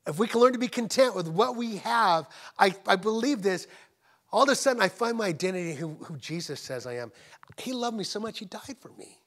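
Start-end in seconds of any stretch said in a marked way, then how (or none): 0.1-6.0 loud, raised, impassioned sermon voice; big preaching delivery with stretched emphatic words; full-force preaching. Real, not acted. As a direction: drive it out to the congregation, hammering key words.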